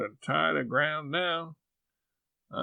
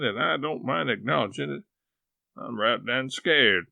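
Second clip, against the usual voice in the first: frantic worry; 3 semitones higher than usual. Acted. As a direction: wring your hands over it. Frantic worry about Sis.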